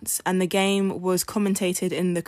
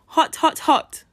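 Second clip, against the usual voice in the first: high, loud demo yell of hot; shouted hot; 9 semitones higher, 6 dB louder, slower than usual. Acted. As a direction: yell it as if feeling the heat. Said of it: "he shouted".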